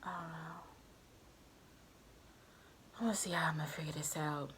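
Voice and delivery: quiet self-talk whisper; whispered aside. Real, not acted. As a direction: mutter it under your breath. Muttering to herself while planning.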